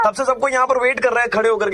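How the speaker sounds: high, loud mock complaint; put-on scolding. Prank caller scolding a stranger for not coming, loudly indignant. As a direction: play an indignant caller kept waiting.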